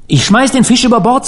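high-pitched, agitated resolve; agitated declaration. A narrator's reading. Harvey throwing away the tainted fish, agitated declaration. Frightened Harvey rejects his catch.

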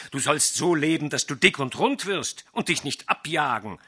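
loud, high, furious lecture; angry sorcerer. A narrator's reading. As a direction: threaten him, building in rage. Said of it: "rief Pinais".

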